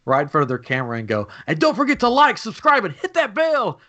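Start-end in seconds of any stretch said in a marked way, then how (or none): 1.5-3.8 high-pitched hammed-up streamer sign-off; mock vlogger plug. Man teasing two live streamers at Epcot, high mock vlogger voice.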